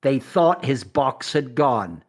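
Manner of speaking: slow, dramatic trailer narrator; big announcer intro, drawn out and portentous; mock intro voice-over. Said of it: "Imagine this intro".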